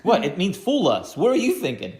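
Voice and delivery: mock outrage at the question; indignant. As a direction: act offended to make them feel bad. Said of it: "make them feel bad".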